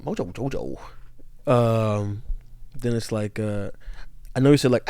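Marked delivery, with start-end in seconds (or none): 0.0-0.8 low ad-lib echo. Retold or imitated. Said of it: "[name]'s, like, ad-libbing here".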